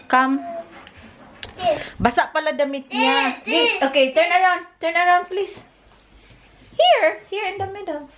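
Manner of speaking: high cooing sing-song. Real, not acted. Baby talk while dressing child.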